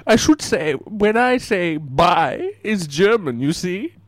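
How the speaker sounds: light mock Italian-German mobster accent; a hint of a thick foreign accent, deadpan; put-on Godfather accent. Caller plays a Godfather, mild mock foreign accent. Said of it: "it's probably my accent".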